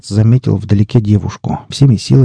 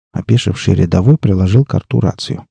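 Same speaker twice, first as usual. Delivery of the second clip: ordinary delivery, nothing marked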